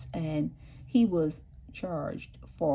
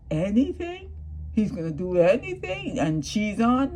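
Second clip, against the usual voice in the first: mocking disbelief; 4 semitones higher, 3 dB louder than usual. Real, not acted. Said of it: "mocking disbelief".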